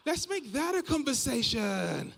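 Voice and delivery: high, loud, drawn-out enthusiasm; mock over-eagerness. Forcing a connection with everyone, mock-eager. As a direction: push the chat with hammed-up glee.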